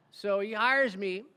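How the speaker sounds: ordinary talk, nothing marked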